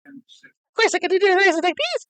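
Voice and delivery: very high cartoon squeak; squeaky high voice. Shown as a demonstration. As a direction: pitch way up like a cartoon. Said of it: "I can do a myriad of voices".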